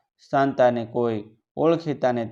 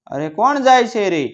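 calling out; 9 semitones higher, 3 dB louder than usual. A narrator's reading. Second call, much higher.

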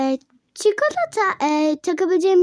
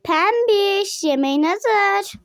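sing-song call to dinner; 3 semitones higher, slower than usual. Acted. Mother calls Pembiş to come eat, calling out. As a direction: call the name out brightly across the room.

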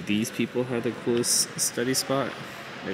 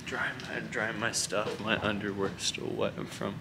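quiet; 11 dB quieter than usual. Real, not acted. Talking quietly in a library.

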